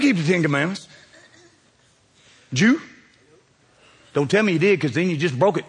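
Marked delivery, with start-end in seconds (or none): none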